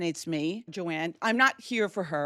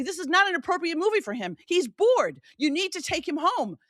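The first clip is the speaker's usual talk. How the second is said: enraged; 8 semitones higher than usual. Retold or imitated. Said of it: "I'm so enraged with these people".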